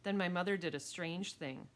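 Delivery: plain reading voice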